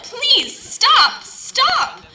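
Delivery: very high, loud desperate cry. Acted. Teacup burning in the oven, high loud cry. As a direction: scream to be let out of the oven. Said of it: "I cried out".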